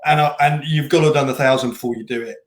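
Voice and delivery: normal talking voice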